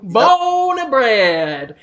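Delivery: slow, hammed-up American drawl; mock American accent. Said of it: "You sound so".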